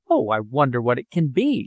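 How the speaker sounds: ordinary delivery, nothing marked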